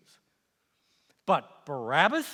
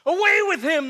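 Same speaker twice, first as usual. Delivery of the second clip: furious mob scream, much higher and louder; crowd screaming; 12 semitones higher, 10 dB louder than usual. Acted. Angry mob screaming at the prisoner, a furious scream. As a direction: hurl it at the prisoner like an enraged crowd. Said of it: "they screamed".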